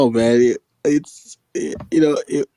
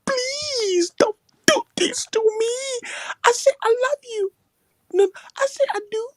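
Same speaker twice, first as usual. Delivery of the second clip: hammed-up sobbing desperation; mock begging. Retelling a man begging a woman, mock crying. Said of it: "hearing a man beg a woman".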